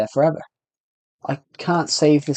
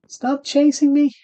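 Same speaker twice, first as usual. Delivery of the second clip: shrill plea stretched out, far higher and slow; high slow shriek; 13 semitones higher, slower than usual. Real, not acted. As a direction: cry out in high panic at the chaser. Mob chasing him in game.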